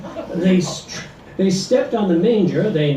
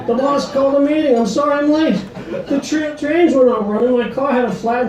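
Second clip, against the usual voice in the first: high, loud, rushed pleading excuses; husband's excuses; 8 semitones higher, 4 dB louder, faster than usual. A narrator's reading. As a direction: gush excuses at a fast clip. Husband's excuses in story poem.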